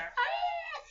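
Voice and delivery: a tiny dog's sharp yip, very high. Retold or imitated. Imitating the neighbor's tiny dog for viewers, a high yip. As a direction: yap like a teeny little dog. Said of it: "like that".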